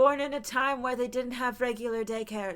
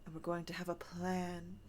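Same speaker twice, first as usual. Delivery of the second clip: mock-composed whisper, much softer; hushed calm whisper; 5 semitones lower, 16 dB quieter than usual. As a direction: whisper it like a cool-headed schemer. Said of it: "presented as like".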